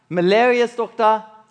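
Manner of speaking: ordinary talk, nothing marked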